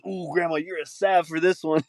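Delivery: high-pitched mock gushing kid; slang-talking grandkid. Kid thanking grandma for a gift, mock gushing. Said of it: "grandma brought him a gift for Christmas".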